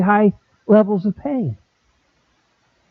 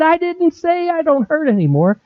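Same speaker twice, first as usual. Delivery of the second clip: emphatic; 11 semitones higher than usual. Retold or imitated. Correcting the doubters.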